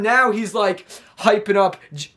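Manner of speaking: normal talking voice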